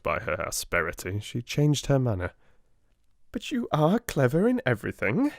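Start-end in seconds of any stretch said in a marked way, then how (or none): 3.3-5.3 approving. A narrator's reading. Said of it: "she said in her approving way".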